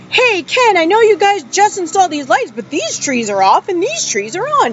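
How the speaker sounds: bright call-out. Retold or imitated. Retells calling out to Ken.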